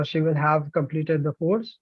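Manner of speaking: ordinary delivery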